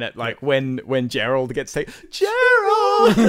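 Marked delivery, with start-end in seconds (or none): 2.1-3.2 very high, laughing mock wail; hammed-up cry of loss; anguished scream. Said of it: "the pain scream".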